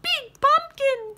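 very high squeaking bat; squeaky bat. Acted. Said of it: "said the bat".

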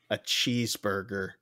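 low, soft retold request; hushed reveal. Diner tells the chef what she wants, hushed reveal. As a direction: drop to a hush for the punchline.

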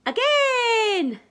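high joyful shout. Acted. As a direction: yell it out gleefully. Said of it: "shouted Sam".

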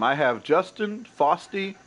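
ordinary talk, nothing marked

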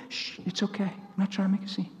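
calm them down in a hush. Retold or imitated. Quieting a startled stranger in a grocery line, hushed. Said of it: "calming the stranger".

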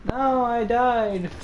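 high, drawn-out lament; stretched-out gamer groan; dismayed whine. Real, not acted. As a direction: wail it slowly in mock despair. Laments dying in the game.

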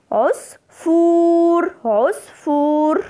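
"عصفور" slowly, waw sustained on one note; stretched long vowel. Shown as a demonstration. Teacher showing pupils the madd, drawn-out oo in "عصفور". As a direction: hold the oo of "عصفور" very long and high. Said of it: "مد بالواو".

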